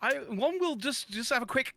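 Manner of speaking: high, hammed-up posh English voice; mock posh English. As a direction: parody a very proper English speaker. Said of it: "it's my posh voice".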